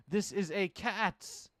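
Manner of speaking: very slow, drawn out; insisting on the secret, very slowly; slow ominous insistence. Acted. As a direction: spell it out slowly. Stranger insisting, slow and strange.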